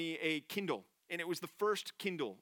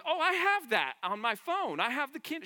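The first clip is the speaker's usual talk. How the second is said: put-on Southern accent; 10 semitones higher, 6 dB louder than usual. Preacher mimicking a lady on the plane, Southern accent. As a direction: imitate an excited lady's thick Southern accent. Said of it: "most southern accent we, I've heard in a long time".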